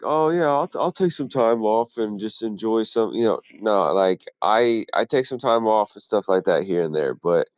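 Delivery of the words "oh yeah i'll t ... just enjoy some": lazy carefree self. Retold or imitated. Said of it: "where I was like".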